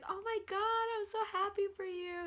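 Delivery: very high, gushing; retold high-pitched delight; imitating thrilled sister. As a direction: squeal with joy for someone else.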